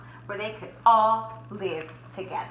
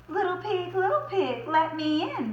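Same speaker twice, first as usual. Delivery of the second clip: mock bellow; 8 semitones higher than usual. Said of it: "bellowed the wolf".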